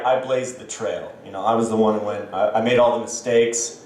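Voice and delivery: usual speaking voice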